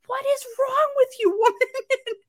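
high-pitched, incredulous retort; hammed-up exasperated protest; mock exasperation. Acted exasperation at retold grandma.

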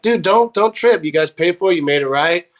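high-pitched retold reassurance easing down; emphatic reassurance. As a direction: wave off an apology, imitating. Band crew brushing off apology.

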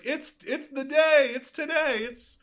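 high, slow, gleeful chant; sing-song child excitement; excited kid. Retold or imitated. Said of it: "That's just like".

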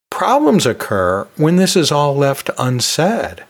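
normal talking voice

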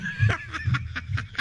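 high airy wheezing laugh; breathless laughing fit. Real, not acted. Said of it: "such a contagious laugh".

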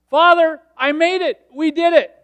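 loud, high cry of victory; triumphant shout. Acted. Jesus reporting to his Father that he made it, triumphant shout. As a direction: proclaim each short phrase at full voice.

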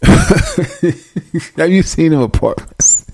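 slow words broken up by laughter; laughing. Real, not acted. Man mocking apartments seen on YouTube, helpless laughter. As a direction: try to get the question out while laughing.

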